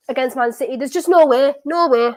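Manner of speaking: normal talking voice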